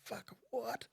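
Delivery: high, breathless whisper; whispered disbelief. Retold or imitated. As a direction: whisper it in shock. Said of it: "I went".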